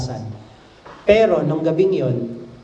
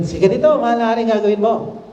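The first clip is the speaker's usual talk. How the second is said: excited; 6 semitones higher than usual. A narrator's reading. Haman eagerly proposes honors.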